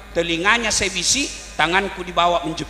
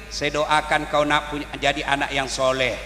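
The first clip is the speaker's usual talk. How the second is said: tender mother; 7 semitones lower, 3 dB quieter than usual. Acted. Mother answers child tenderly.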